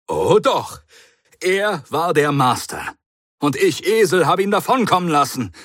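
high, fast and loud self-reproach; furious at being tricked; the Doctor, agitated. Acted. The Doctor rages at himself before the Brigadier, high and loud. The Doctor realises he was fooled.

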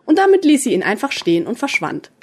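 ordinary delivery

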